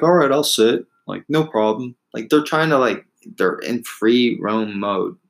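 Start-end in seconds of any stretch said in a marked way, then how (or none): 0.0-2.0 lightly voiced, higher agreeable cow; mock cattle voice. Cattle imagined happily agreeing to stay, mock cheerful. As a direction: play the cattle as easygoing and compliant. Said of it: "they're not just gonna be like".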